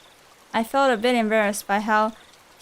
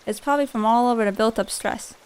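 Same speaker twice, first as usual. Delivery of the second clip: normal talking voice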